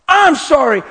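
high, loud sobbing sorry; tearful sorry. Retold or imitated. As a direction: cry the apology. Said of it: "she's repetitious".

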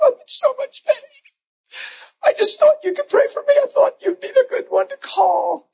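high, tearful woman's voice, close to crying; high-pitched crying plea from a woman bent over in pain; caller in pain. Retold or imitated. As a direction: play the hurting lady pleading for prayer. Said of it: "I had a lady call me one time".

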